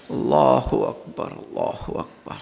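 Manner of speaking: low, slow, fading almost to breath; hushed takbir. Real, not acted. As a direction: let the second die away softly. Preacher's awed exclamation mid-sermon. (مدھم ہوتی، دھیمی تکبیر)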